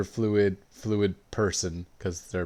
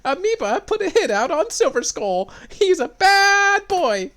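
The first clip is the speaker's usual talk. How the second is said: much higher, louder squeaky newscaster voice; news reporter; 7 dB louder than usual. Retold or imitated. Reporter broadcasting live from the bank, squeaky newscaster. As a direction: ham up a breathless TV reporter. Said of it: "a reporter was like".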